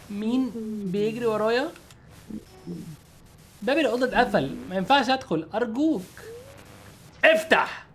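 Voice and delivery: high, soft begging woman's voice; pleading woman. Acted. Woman at the door begging the player to let her in, high soft plea. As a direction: beg through the door, frightened.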